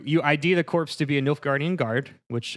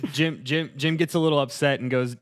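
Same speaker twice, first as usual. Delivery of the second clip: ordinary delivery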